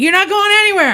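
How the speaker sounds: high mock warning from the gunman; angry threat. Narrator voicing the gunman stopping him, high mock threat. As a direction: push it up high and hard. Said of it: "And he's like".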